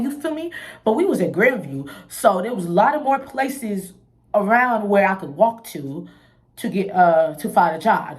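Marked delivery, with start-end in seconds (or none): none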